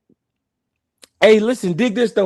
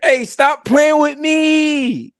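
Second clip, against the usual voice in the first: stretched slow and high; laughing, stop playing; slow, drawn out; 5 semitones higher, much slower than usual. Real, not acted. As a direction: draw out the protest. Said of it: "laughing retort".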